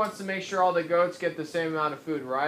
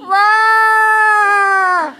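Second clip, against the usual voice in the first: loud, high bleating cry; goat bleat; 16 dB louder than usual. Retold or imitated. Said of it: "I made a pretty good goat impression".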